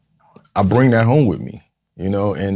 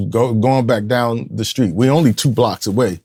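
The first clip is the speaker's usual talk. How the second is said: ordinary talk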